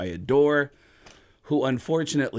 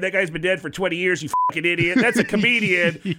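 Tom Arnold angry; 6 semitones higher, 5 dB louder than usual. Retold or imitated. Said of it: "Tom will tweet back at people and go".

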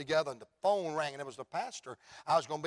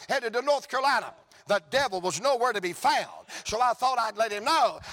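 raised, driving revival cadence; rousing preaching; 7 semitones higher, 6 dB louder than usual. Real, not acted. Preacher telling church of daughter's salvation, rousing revival preaching. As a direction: preach it out high with rising momentum.

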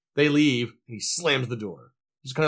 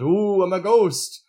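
mock spooky ghost; 6 semitones higher, 3 dB louder, slower than usual. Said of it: "she gets the sheet and goes".

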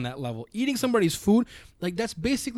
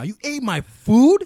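rising angry yell, slowed down; angry shout; 8 semitones higher, 8 dB louder, slower than usual. Retold or imitated. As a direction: confront them, shouting and slow. Acted confrontation over stolen food.

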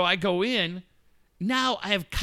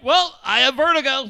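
high, loud, comically sure; pitched-up, hammed-up reasoning; mock self-diagnosis; 8 semitones higher, 6 dB louder than usual. Stoned man explains away his symptoms, mock certainty.

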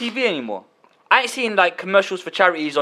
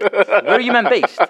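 mock questioning; 5 semitones higher, slower than usual. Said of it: "I'm like".

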